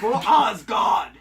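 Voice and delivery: slow, stretched shouted war cry; viking battle cry. Pretend. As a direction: drag out the cry like a warrior.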